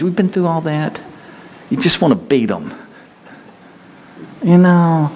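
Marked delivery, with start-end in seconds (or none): none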